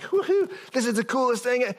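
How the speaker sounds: high, giddy mock cheer; mock excitement. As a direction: play the carefree fan for laughs. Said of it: "He wasn't sitting there going like".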